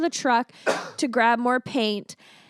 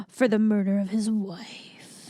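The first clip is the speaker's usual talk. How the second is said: hushed, scandalized whisper; ominous stage whisper; mock dramatic reveal; 3 semitones lower, 3 dB quieter than usual. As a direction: whisper it like a murder-mystery twist.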